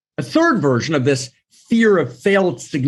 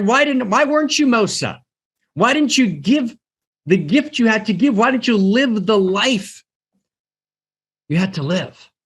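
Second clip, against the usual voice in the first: heavenly question; 8 semitones higher than usual. Retold or imitated. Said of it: "And the question's gonna be".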